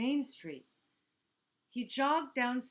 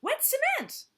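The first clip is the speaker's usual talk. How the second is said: high loud warning; shout; 7 dB louder than usual. Acted. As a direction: warn him off the cement. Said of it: "she shouted".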